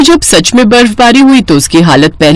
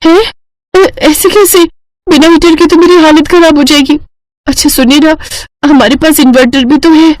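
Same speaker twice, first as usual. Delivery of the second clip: put-on higher, pleading young wife; distressed cold Aditi; 6 semitones higher than usual. Wife begs husband for heat without power, distressed. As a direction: plead through the chill.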